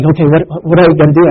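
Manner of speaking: fast, hurried panicky thinking; mock panic. Student pilot in a dive, rushing in panic. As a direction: rush it like a pilot losing control. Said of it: "he started to panic".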